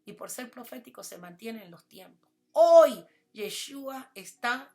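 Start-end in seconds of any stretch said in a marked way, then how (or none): 2.5-4.7 loud, rousing sermon delivery; full-voiced pulpit preaching; impassioned preaching. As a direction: preach it to the congregation with fire. Preaching climax.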